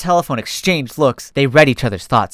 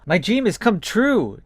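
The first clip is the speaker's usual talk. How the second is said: higher, slow little creature voice; contented squeak; 6 semitones higher, slower than usual. Acted. Dragon angel squeaking her contentment, lightly higher. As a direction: content and cozy, a small squeak. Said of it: "squeaked out contently".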